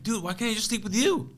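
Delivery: high-pitched pleading; whiny plea. Retold or imitated. Begging his friend to let him stay, high whiny plea. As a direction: plead up high like a scared kid. Pleading not to sleep alone.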